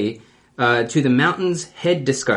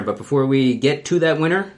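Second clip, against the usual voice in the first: ordinary talk, nothing marked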